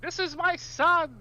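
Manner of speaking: high, slow proud announcement; proud introduction. Pretend. Introducing a pet.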